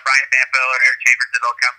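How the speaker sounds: ordinary talk, nothing marked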